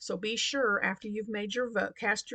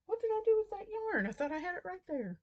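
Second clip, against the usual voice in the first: flustered surprise; 10 semitones higher, faster than usual. Real, not acted. Searching for lost yarn.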